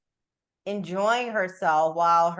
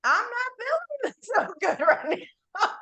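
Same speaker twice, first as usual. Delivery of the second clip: high, laughing confession; amused, high-pitched mock unease; laughing mock admission; 11 semitones higher than usual. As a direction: break into laughter on an imitated complaint. Acted quote of unfulfilled achiever, laughing.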